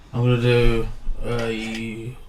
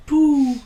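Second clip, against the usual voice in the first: high, drawn-out owl hoot; 13 semitones higher than usual. Shown as a demonstration. Offering another owl noise for the game, owl hoot. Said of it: "an owl noise".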